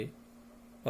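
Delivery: अ held long and slow from the throat; slow teaching demo of अ; drawn-out vowel demo. Shown as a demonstration. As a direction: stretch अ out slowly so the throat sound is clear. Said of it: "इसका अभ्यास करेंगे".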